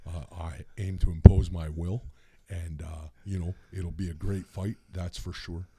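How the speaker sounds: low, mumbled, calm wrestler voice; low calm Hulk Hogan. Shown as a demonstration. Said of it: "that's how Hulk Hogan would talk".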